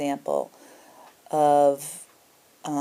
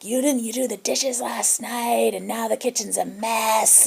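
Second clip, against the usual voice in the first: mock roommate yell; 7 semitones higher than usual. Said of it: "she starts screaming at me like".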